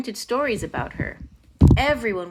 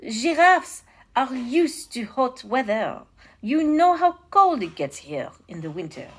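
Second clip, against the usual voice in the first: attempted French accent; 4 semitones higher, 4 dB louder than usual. A narrator's reading. Counselor cautioning his king, polite French accent. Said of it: "my attempt at a French accent".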